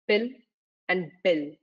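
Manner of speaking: "pill" and "bill" set apart, first consonants distinct; clear consonants. Shown as a demonstration. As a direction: say "pill", pause, then "bill", keeping p and b clear. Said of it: "make sure the consonants are clear".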